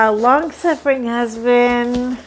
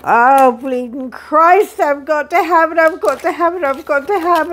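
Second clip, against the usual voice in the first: pleading, higher, building want; desperate longing; 4 semitones higher than usual. Retold or imitated. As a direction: beg yourself to buy it. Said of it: "I was like".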